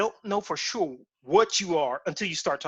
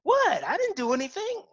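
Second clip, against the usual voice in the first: high-pitched retold innocence; mock innocent protest; 12 semitones higher than usual. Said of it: "I'm like".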